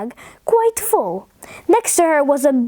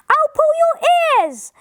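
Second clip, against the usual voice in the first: very high storybook yell; put-on angry holler without much volume; 12 semitones higher than usual. A narrator's reading. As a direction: threaten in a mock shout, pitched right up. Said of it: "yelled the man".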